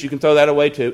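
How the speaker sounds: ordinary talk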